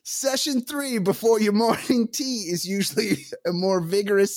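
hammed-up British accent, pitched high; badly faked high British voice, rising to the question; revolting British accent. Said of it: "Revolting British accent".

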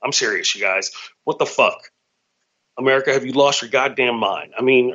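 ordinary delivery

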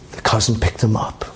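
ordinary talk

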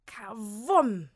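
loud "Kawumm"; crash sound. A narrator's reading. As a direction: boom it out like a crash. Geräusch des Aufpralls. (lautmalerisch krachen)

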